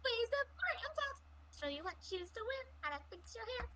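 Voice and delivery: very high falsetto lyrics. Acted. Friend joins singing Popular, very high falsetto. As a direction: pipe it up like a Broadway girl.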